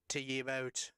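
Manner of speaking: ordinary talk, nothing marked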